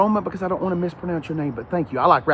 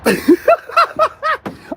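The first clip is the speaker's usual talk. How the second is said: high, hearty laugh; big laugh; 5 dB louder than usual. Real, not acted. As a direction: laugh out loud. Laughing at a comment.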